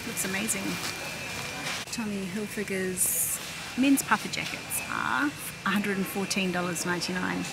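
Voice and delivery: usual speaking voice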